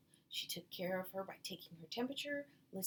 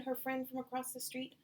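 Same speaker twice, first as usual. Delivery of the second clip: usual speaking voice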